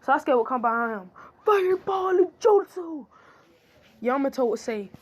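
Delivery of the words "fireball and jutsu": high, loud, slow battle cry; attack shout. Acted. Sasuke attacking Yamato from behind, shouting the jutsu. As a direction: bellow the jutsu name out.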